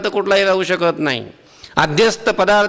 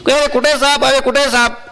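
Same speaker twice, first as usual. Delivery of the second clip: mocking, raised voice; scoffing; 7 semitones higher than usual. Villager tosses the rope aside and scoffs at the scared man. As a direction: scoff at the silly fright.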